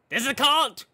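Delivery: high, loud indignant cry; high defiant yelling; defiant shout. Acted. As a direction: shout it like you won't back down.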